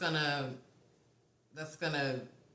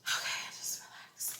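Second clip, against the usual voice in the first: voiceless whisper to oneself; self-soothing whisper; 4 dB quieter than usual. Real, not acted. Reader calming down after crying, whispering to self. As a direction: whisper it privately to settle down.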